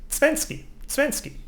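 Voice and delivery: zwanzig high, as svenski; sing-song Swiss zwanzig; Swiss pronunciation. Shown as a demonstration. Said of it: "in Switzerland they say".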